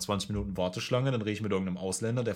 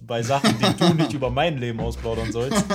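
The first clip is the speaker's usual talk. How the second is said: laughing; 2 semitones higher, 9 dB louder than usual. Real, not acted. Teasing complaint, laughing.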